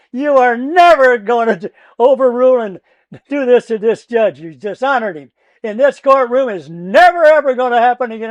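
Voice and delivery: loud, high, furious courtroom outburst; retold screaming. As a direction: tear into the opposing lawyer at full volume. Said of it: "And I'm screaming".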